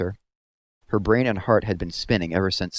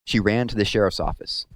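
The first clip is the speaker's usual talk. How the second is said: usual speaking voice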